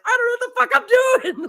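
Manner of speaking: very high laughing mock panic; panicked rider. Mocking the new rider flying through the city, high laughing panic. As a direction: squeak it out, laughing at the clueless rider. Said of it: "He's like".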